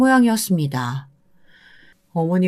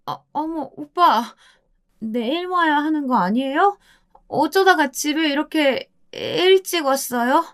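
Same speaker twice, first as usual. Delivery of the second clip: flustered surprise; 9 semitones higher than usual. Acted. Wife caught, feigns surprise.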